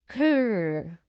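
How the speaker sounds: playful growl like a toy dinosaur. Acted. George showing off his new dinosaur, growling playfully. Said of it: "he shouts".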